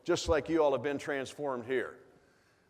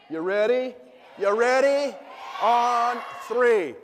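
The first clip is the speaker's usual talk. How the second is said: each call louder and higher; hype building to a shout; 7 semitones higher, 7 dB louder, slower than usual. Real, not acted. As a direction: pump up the crowd to a yell. Firing up the crowd.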